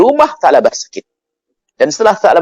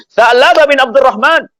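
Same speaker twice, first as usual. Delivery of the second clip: high, drawn-out call of a name; 12 semitones higher, 3 dB louder, slower than usual. Acted. Umar calls to the boy he has found, calling out. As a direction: call out slowly, pitched well up. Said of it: "Sayyidina Umar menyuruh".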